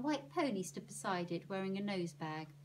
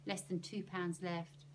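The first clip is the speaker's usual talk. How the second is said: plain reading voice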